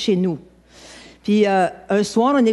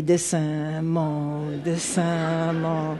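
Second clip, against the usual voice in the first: muttered mock plea; 5 semitones lower, 6 dB quieter, much slower than usual. Man rolling in the church aisle.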